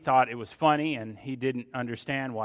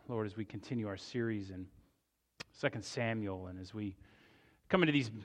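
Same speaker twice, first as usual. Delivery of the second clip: quiet, lower-pitched prayer with pauses; opening prayer; 3 semitones lower, 5 dB quieter than usual. Real, not acted. Pastor leading the congregation in prayer before the sermon, quiet and reverent. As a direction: pray softly and reverently, unhurried.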